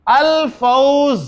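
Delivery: drawn-out Arabic word; slow recitation. Shown as a demonstration. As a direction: say it slowly for the class. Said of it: "फा". (धीमा उच्चारण)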